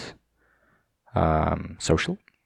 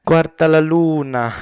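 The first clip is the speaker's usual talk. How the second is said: loud, high Italian quote, hammed up; a hammed-up Italian lover line in the sky; Paolo from Friends; 9 semitones higher, 7 dB louder than usual. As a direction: belt it out like the Friends Italian charmer. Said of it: "quoting Friends".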